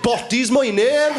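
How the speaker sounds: high, slow robber introducing himself. Retold or imitated. Robber voiced in story.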